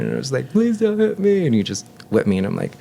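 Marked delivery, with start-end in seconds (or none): 0.5-1.5 put-on high, pleading little-kid voice as a scared child; childish begging. Remembering a dad about to punish him, a high scared kid's plea.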